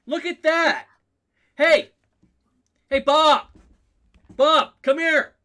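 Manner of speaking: loud, high-pitched hollering across the room; hammed-up excited yell; calling out. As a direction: shout for a coworker to come and look. GM acts calling Bob over.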